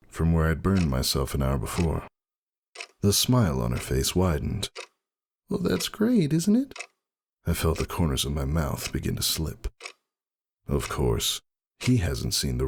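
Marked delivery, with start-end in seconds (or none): 5.5-6.8 much higher, hopeful mare's voice; hopeful Roseluck. A narrator's reading. Roseluck, happy to hear she will be fine. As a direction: brighten up at the good news.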